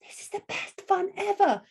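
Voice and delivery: high, squealing storybook delight; joyful high-pitched character cheer; joy and excitement. Acted. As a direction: burst out with gleeful excitement. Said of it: "with a feeling of joy and excitement".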